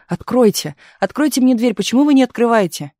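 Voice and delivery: rattling the handle and yelling to be let in; desperate demand. A narrator's reading. Tugging at locked door.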